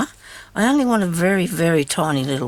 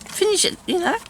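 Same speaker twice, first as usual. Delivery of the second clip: high chiding self-talk; 14 semitones higher than usual. Retold or imitated. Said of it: "'Cause I thought".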